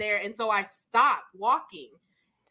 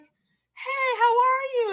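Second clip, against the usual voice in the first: high bright greeting; 13 semitones higher, 5 dB louder, much slower than usual. Retold or imitated. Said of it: "Chris Evans is like".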